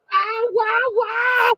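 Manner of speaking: very high yelping cries; shrill truck wails; crying trucks. A narrator's reading. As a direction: yelp like a startled truck. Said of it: "he cried again".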